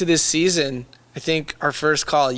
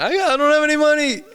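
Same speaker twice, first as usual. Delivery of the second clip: high, far-off whiny plea; whiny mock voice; 13 semitones higher, 4 dB louder than usual. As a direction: whine like a broke guy begging. Mock whining about money to partner.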